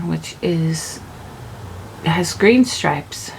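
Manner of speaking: slow, halting recital of details; picture each detail slowly, piece by piece; lightly voiced daydreamer. Manifester piecing together a beach vacation, lightly dreamy. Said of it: "at first they're like".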